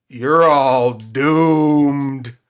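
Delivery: slow, loud, ominous prophecy; ominous creepy drawl. Acted. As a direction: drag out the doom like a creepy vagrant. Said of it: "he looks up and goes".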